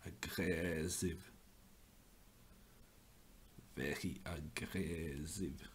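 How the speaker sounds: drawn-out hammed-up Russian accent, playing a tough grandmaster; fake Russian accent. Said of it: "the Norwegian imitation of aggressive Russian grandmaster".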